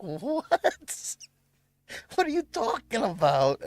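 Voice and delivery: sudden high, disbelieving "What?"; incredulous what. Retold or imitated. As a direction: caught off guard by the scolding. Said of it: "I'm like".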